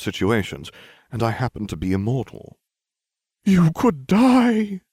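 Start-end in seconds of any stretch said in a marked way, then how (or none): 3.4-4.8 high, loud, very slow insistence; insisting. A narrator's reading. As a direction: press the doom on him like a seer. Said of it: "she insisted".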